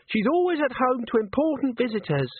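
a bright, high welcoming voice; high cheerful young hostess. Acted. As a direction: beam at the guests. Colette welcoming visitors.

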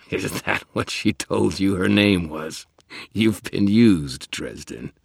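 taunting creature voice; mocking vampire. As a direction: sneer like an amused predator.

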